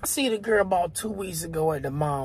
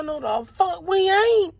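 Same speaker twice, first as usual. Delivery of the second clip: high-pitched indignant comeback; indignant denial; 8 semitones higher than usual. Retold or imitated. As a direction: reject it outright, voice pitched up.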